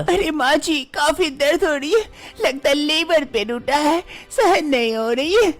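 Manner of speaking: put-on high, pained young woman's voice; young wife in pain. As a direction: plead with mother-in-law through pain. Manisha in pain.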